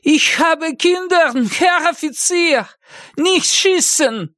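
very high, drawn-out begging; high-pitched desperate German plea; crying out. Acted. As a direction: plead for your life in a high, slow wail. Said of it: "крикнул он".

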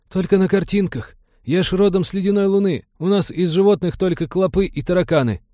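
high-pitched innocent recruit; naive crewman. A narrator's reading. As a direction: explain it in a high, artless voice.

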